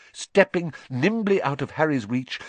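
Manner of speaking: usual speaking voice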